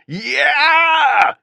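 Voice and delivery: very high, loud howled cry; father howling with joy across empty plains; howled yeah. Acted. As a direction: let out a triumphant bellow. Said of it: "he howled".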